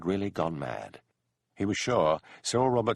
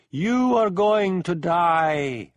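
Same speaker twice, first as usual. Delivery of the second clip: high, loud, very slow ghostly threat; mocking eldritch voice; 10 semitones higher, 8 dB louder, much slower than usual. A narrator's reading. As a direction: savour the threat like a spirit. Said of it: "mocked the eldritch voice".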